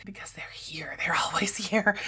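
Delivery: soft whisper with a giggle. Real, not acted. Kids still asleep nearby.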